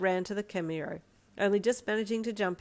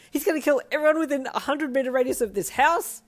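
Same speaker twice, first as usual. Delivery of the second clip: high, loud giddy panic; panicked wail; 8 semitones higher, 8 dB louder than usual. Acted. Panicking to Sedano about Darby, loud excited wail. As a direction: rant it with thrilled alarm.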